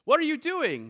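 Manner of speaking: the prince tries to sound royal and in charge; regal royal tone. Acted. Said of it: "in his most regal and royal tone he could muster".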